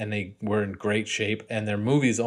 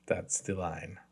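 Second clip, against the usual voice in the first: ordinary talk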